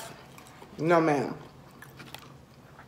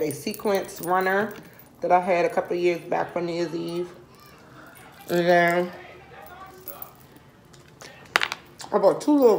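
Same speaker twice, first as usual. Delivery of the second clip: talking around chip; chewing between words; mouth full, chewing. Real, not acted. Seen 0: hand to mouth with chip, lips working while talking early on.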